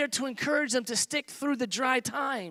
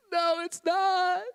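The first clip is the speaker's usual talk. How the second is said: mock downcast friend; 7 semitones higher, much slower than usual. Discouraged friend answering.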